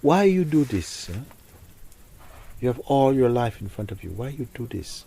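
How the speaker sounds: tearful pleading. Retold or imitated. Retelling how she begged her dead son, mild tearful plea. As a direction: beg him why, a touch of tears in it. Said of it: "I was crying, I was so sad".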